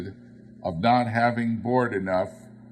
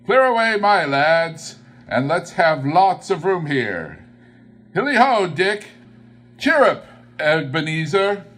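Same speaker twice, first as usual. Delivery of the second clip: much higher and louder, jolly hearty calls; rally the lads like a cheerful old master; merry calling out; 7 semitones higher, 7 dB louder than usual. A narrator's reading. Fezziwig calling to his apprentices to clear the room, jolly loud calls.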